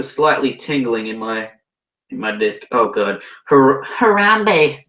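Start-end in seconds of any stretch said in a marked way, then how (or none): 3.5-4.8 high, loud, drawn-out shriek; breathed shriek. Acted. Narrator calling to Harambe, shrieking. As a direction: stammer into a slow, high cry of the name. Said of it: "I breathed shriekingly".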